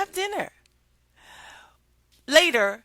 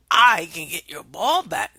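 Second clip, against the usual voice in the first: frog voice; 2 semitones lower, 8 dB louder than usual. Acted.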